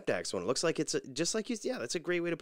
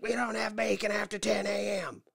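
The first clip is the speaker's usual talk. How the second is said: much higher, curt mock cashier cutting in; snippy cashier; 13 semitones higher than usual. Cashier interrupts the customer's order, mock-high and snippy. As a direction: snap it off like an annoyed teen clerk. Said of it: "this girl interrupts her".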